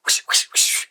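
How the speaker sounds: breathy whoosh sounds; whooshing noises. Retold or imitated. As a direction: sweep the air like waving a bill. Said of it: "And then I go".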